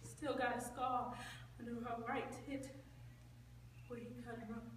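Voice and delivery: very soft, low, halting; hushed repeat. Acted. Narrator dwelling on Crystal's scar, hushed. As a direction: let it drop almost to nothing.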